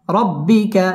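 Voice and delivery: usual speaking voice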